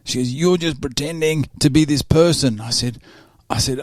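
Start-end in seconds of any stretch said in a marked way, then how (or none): 0.3-2.6 imitated accusation, pitched higher; touch aggressive. Young woman accusing podcaster of pretending, touch aggressive. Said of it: "a touch aggressive young lady".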